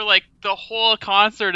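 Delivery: usual speaking voice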